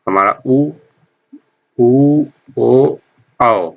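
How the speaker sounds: उ, ऊ, ओ, औ said with rounded lips; rounded vowels demo. Shown as a demonstration. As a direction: show the lip rounding on उ and ओ. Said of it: "इनको बोलने में हमारा जो होंठ है".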